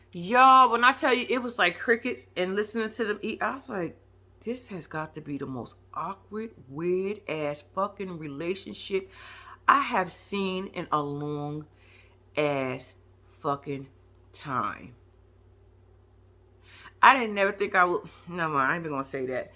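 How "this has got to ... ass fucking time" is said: slow, low and quiet, word by word; low, soft, drawn-out deadpan; slow deadpan disbelief. Retold or imitated. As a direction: drag it out under your breath, low and stunned. Her stunned reaction to the silence.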